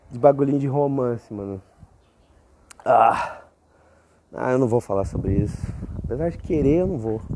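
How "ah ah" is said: ordinary talk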